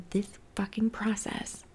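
whispered aside. Real, not acted.

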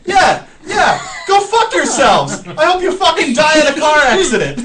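high, loud mock shouting; retold angry yell. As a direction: re-enact yelling insults at people walking away. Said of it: "And then I'm just like".

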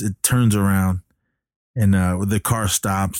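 normal talking voice

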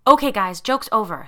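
loud, nervous, shaky plea. A narrator's reading. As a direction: call off the joke, mildly scared. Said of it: "in a shaky voice".

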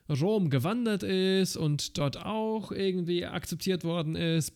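normal talking voice

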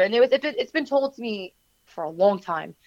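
ordinary delivery

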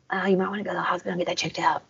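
quick, softly imitated suggestion; hushed aside. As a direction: rattle it off softly, imitating offhand advice. Voicing Zoot's deadpan advice.

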